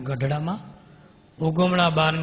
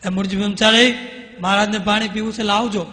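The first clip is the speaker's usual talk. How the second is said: higher, louder call across the room; 5 semitones higher, 7 dB louder than usual. Retold or imitated. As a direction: shout the name to summon him. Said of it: "Brahmanand Swami બૂમ મારી". (દૂરથી બૂમ પાડીને બોલાવવું)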